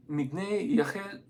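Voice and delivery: slow, halting French accent. Shown as a demonstration. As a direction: attempt the French name slowly in a French accent. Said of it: "My French accent sucks".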